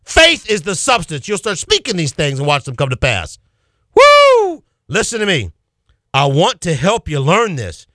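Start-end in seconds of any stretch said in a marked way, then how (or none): 4.0-4.6 high, loud joyful whoop; a preacher's ecstatic cry; excited whoop. Real, not acted. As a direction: let out a shout of praise.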